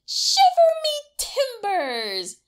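high, slow pirate yell. A narrator's reading. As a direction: bellow it like a pirate. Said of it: "yelled Fergus".

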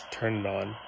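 ordinary delivery

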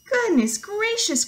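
slow, high, loud hen exclamation; hammed-up shocked hen; alarmed cry. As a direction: cry it out in drawn-out storybook alarm. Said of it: "cried Hen".

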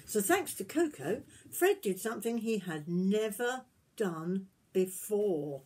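gentle storybook reading voice; reading to children. Acted. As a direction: tell it like a bedtime story to little ones. Reading picture book aloud.

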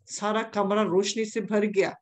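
normal talking voice

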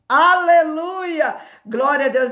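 loud, high, drawn-out hallelujah; exultant praise. Real, not acted. Preacher rejoicing in deliverance from death, exultant praise. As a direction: cry it out in praise.

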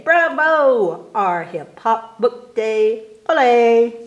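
loud high ole and bravo; loud festive cheer. Acted. Reading the Book Day poem to kids, loud festive cheer. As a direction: cheer like a crowd at a fiesta.

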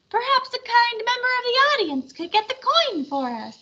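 high squeaky little puppet; tiny puppet voice. Acted. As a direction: play the diminutive Half Pint. Half Pint puppet speaks.

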